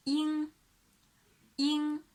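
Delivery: "in" modelled with a back nasal; velar demo syllable. Shown as a demonstration. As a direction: arch the tongue for "in". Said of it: "Move back your tongue and form an arch here".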